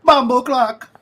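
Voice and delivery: high, loud mock Jamaican exclamation; Jamaican accent. Host echoing his friend in a loud mock Jamaican accent. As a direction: shout it in a hammed-up Jamaican voice. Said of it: "then I went Jamaican".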